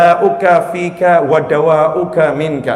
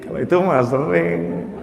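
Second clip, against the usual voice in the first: chuckling through the words; husband laughing at his own sin; laughing admission; 5 dB quieter than usual. Retold or imitated. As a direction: laugh while admitting it.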